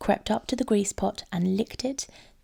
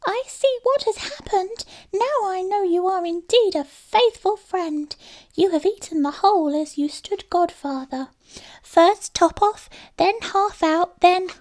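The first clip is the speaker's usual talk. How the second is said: high mouse voice, louder and slowing on the names; a mouse piecing together the betrayal; accusing little mouse; 11 semitones higher, 5 dB louder than usual. A narrator's reading. Mouse realizes the theft.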